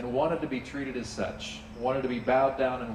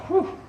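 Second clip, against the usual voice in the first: relieved whew; 5 dB louder than usual. Retold or imitated. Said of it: "he's thinking to himself".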